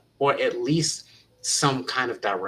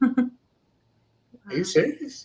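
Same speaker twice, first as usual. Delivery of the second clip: high, slow, drawn-out disbelief; a disbelieving, stretched-out question; stunned disbelief; 12 semitones higher, much slower than usual. Retold or imitated. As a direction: let the pitch fly up in amazement. Stunned reaction to kid's praise.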